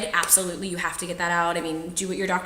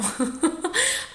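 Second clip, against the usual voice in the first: laughter; 10 semitones higher than usual. Real, not acted. Laughing at own story.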